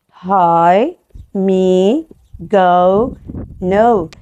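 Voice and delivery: hi, me, go, no with long, drawn-out open vowels. Shown as a demonstration. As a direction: let the final vowel of hi, me, go, no ring on. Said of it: "and always long". (حروف علة طويلة ممدودة في hi, me, go, no)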